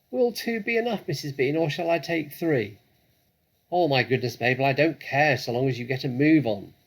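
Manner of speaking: high, loud calls up the stairs; high calling. Acted. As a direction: shout it up to the mistress. Mabel and Mrs Bean calling.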